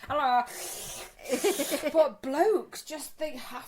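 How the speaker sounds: very slow, high, stretched out; drawn out. Real, not acted. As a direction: drag the words out in disbelief. Drawn-out exasperation.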